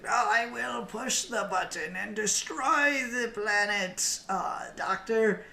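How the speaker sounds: high, drawn-out villain rant; hammed-up megalomaniac, pitched well up; Davros impression. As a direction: slowly declare doom in a strained villain voice. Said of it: "And he's like".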